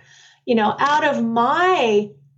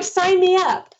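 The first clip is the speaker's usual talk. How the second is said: eager sign-up; 8 semitones higher than usual. Retold or imitated. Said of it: "I'm like".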